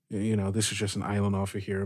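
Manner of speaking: usual speaking voice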